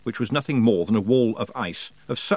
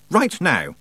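high, louder snap; a sharp order in a louder voice; snapped demand; 6 semitones higher, 4 dB louder than usual. Acted. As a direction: bite the words off at him. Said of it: "Tiffany snapped".

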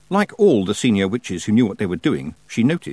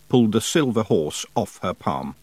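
usual speaking voice

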